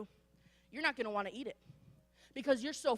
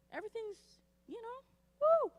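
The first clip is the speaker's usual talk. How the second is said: much higher, squealed woo; carefree mock; 10 semitones higher than usual. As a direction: toss it off like nothing matters.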